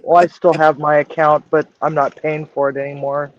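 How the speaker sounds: usual speaking voice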